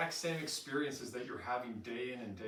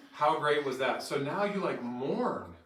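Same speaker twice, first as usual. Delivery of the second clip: ordinary talk, nothing marked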